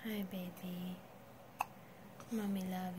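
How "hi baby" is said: lower, much softer whisper; hushed bedtime whisper. Real, not acted. Mother settling baby to sleep.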